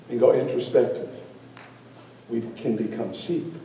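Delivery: low, soft, measured closing lesson; hushed, solemn wrap-up of a fable; storyteller's moral. Acted. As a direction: let the moral land quietly, with pauses.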